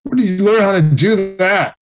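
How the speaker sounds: playing the coach's amazement at a first try; amazed. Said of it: "and he was like".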